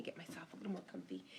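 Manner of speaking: whispered aside. Real, not acted. Aside while settling in.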